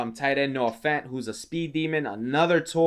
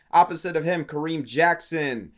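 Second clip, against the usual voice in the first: ordinary talk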